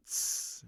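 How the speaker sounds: hissed cymbal hit; cymbal imitation. Shown as a demonstration. Teacher showing how the rapper's sound works, cymbal imitation. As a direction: make a short "tss" like a cymbal. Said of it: "sounds like a cymbal".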